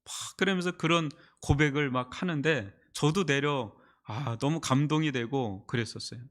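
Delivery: ordinary talk, nothing marked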